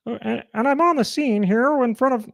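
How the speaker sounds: high puppet frog; reporting as an excitable frog puppet; high-pitched puppet reporter voice; Kermit the Frog. Acted. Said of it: "Kermit the Frog kinda sounds like".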